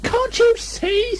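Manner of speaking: very high, slow squeaky mock shout; a tiny pig trying to yell; Piglet's small outraged cry. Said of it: "shouted Piglet".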